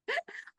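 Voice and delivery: high squeal of a laugh; burst of laughter. Real, not acted. Host laughing at a viewer's funny comment, high squealing laugh. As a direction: let a surprised laugh burst out.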